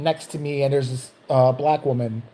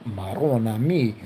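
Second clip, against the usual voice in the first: low, slow Italian-American lament; exasperated oath in Chicago Italian accent; 6 semitones lower, slower than usual. Acted. Guard despairs at man on floor, low slow Chicago Italian accent. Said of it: "lots of Italians in Chicago slipping into that".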